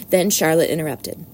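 ordinary delivery

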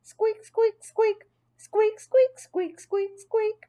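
very high, thin little squeaks; high hamster squeaks in bouncy rhythm; squeaking jingle bells. A narrator's reading. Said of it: "It sounded like jingle bells".

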